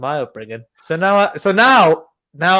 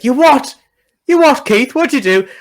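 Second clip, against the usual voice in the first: higher, louder indignant demand; outraged mum; 6 semitones higher, 5 dB louder than usual. Retold or imitated. Mum rounding on Keith over the sandwich, outraged. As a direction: catch him out and demand an answer. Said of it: "she's like".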